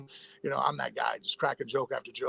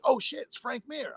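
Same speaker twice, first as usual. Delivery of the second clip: retold starstruck exclamation, high and slow; starstruck recognition; 10 semitones higher than usual. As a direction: imitate a fan's amazed recognition, pitched up and drawn out. Paramedic recognizing the famous fighter.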